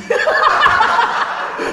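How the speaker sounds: high, loud burst of airy laughter; big laugh. Real, not acted. At the clerk's joke, a loud airy laugh. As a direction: let a huge breathless laugh rip out. Clerk's punchline about world ending.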